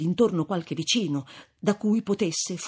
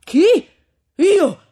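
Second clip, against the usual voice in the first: loud, high, indignant outburst; man yells at the old woman to shut up; angry shout; 13 semitones higher, 8 dB louder, slower than usual. A narrator's reading. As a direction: shout her down, outraged. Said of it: "gridò Renzo".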